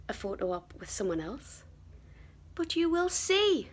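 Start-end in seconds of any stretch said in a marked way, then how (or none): 2.5-3.7 high, drawn-out teaser; teasing. Real, not acted. Vlogger keeping viewers guessing about her photo, teasing. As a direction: dangle it slowly in a high, teasing lilt.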